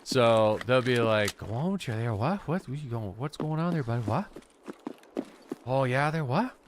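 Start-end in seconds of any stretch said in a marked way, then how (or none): 1.4-6.5 mock Newfie old fella, tagging a rising "what?" on the end; Newfie accent. Imitating old fellas around home, mock Newfie accent. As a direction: do a rough Newfie impression, questioning lilt. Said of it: "I can't do a Newfie accent".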